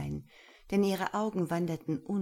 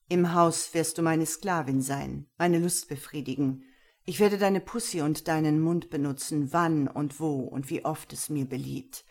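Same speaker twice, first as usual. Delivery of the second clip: louder, measured stern male voice; stern master; 4 dB louder than usual. Acted. Gero sets his rules for Lena, stern and measured.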